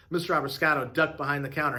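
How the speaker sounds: plain reading voice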